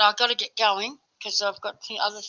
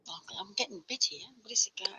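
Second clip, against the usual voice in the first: whispering; 12 dB quieter than usual. Real, not acted. Distracted aside while being bitten.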